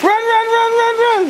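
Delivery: high excited urging; cheering on. Real, not acted. Cheering kid in rain.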